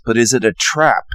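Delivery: usual speaking voice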